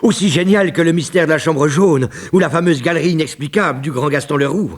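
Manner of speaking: ordinary talk, nothing marked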